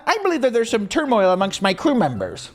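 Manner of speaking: a hint of a cartoon hero, sneering; mocking Luffy's quip. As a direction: parrot the cheesy line mockingly. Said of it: "that little quip he does at the end where he's like".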